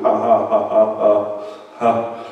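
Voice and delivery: scornful ha ha ha; a short derisive preacher's laugh; mocking laugh. Real, not acted. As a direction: laugh the enemy off. Laughing at the devil.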